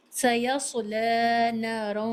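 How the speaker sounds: سیصلی with a bold heavy ص, slow; teacher modelling the correct recitation; heavy saad. Shown as a demonstration. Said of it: "بھاری اور پر آواز سے پڑھیں".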